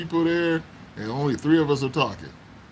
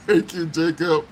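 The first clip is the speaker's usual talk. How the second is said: ordinary talk, nothing marked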